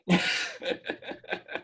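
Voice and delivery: breathy laugh at the punchline; airy laugh. Real, not acted. Storyteller laughing at the end of his story, breathy and silent. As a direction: let out a silent wheezing laugh.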